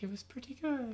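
high, soft and airy. Retold or imitated. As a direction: float it up high on the breath. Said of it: "You're like, high, airy voice".

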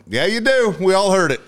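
teasing retort. Retold or imitated. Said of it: "And I went".

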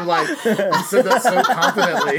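loud, high, laughing teasing; cracking up. Real, not acted. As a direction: tease him through real laughter. Cracking up at the confident guess.

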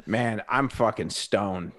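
ordinary delivery

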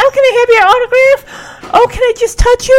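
high, fast breathless fan voice; gushing fangirl. Retold or imitated. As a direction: squeal like a starstruck fan. Fans begging Rick.

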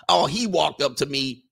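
ordinary talk, nothing marked

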